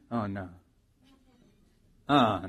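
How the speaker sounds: ordinary talk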